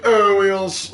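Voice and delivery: high, loud mock-elf voice; self-righteous elves. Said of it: "I'm tired of saying, like, elves".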